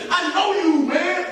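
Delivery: lightly voiced recognition; recognizing him. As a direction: call it out like you just placed his face.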